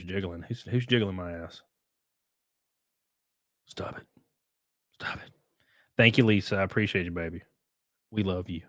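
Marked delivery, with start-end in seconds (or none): 3.7-4.1 hushed. Real, not acted. Phone jiggling, hushed aside. 5.0-5.3 a breathy whispered stop it. Real, not acted. Phone jiggling, whispered aside.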